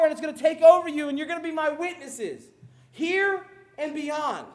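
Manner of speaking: high, loud fervent preaching. Acted. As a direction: preach it to the back row. Preaching at full force.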